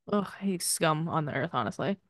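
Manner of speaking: ordinary talk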